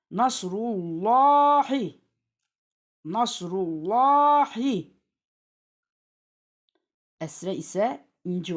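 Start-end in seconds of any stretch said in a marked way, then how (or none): 0.1-4.9 "Nasrullahi" chanted with a heavy, long "llaa"; thick lam recitation. Shown as a demonstration. Teacher demonstrating the rule, "Nasrullahi" with thick lam. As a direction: recite "Nasrullahi" slowly, lam full and dark. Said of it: "ötre ise kalın okuyoruz".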